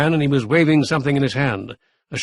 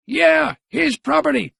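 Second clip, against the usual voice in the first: put-on much higher, gleeful old man; high shrill old Gramp; 13 semitones higher, slower than usual. A narrator's reading. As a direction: crow it at the mayor. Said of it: "shrilled Gramp".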